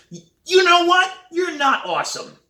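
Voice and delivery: very high, loud outburst; talking out loud. A narrator's reading. Sam alone at drum desk, loud indignant retort. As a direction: burst out with indignant force. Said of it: "I say out loud".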